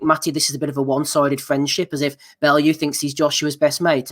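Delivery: ordinary talk, nothing marked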